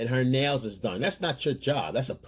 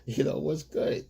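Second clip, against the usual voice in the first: mock concerned boyfriend; 5 semitones higher, 4 dB quieter than usual. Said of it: "So you go and say".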